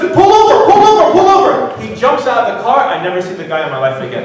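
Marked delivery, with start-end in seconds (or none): 0.0-1.8 much higher and louder, frantic pleading; a terrified carjacker begging him to stop; panicked yelling. Retold or imitated.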